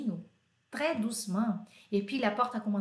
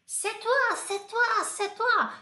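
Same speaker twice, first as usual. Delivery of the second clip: put-on kid shouting accusation; 9 semitones higher than usual. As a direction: scream it like a crying boy pointing. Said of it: "gritou Agnan". (grito agudo de menino acusando)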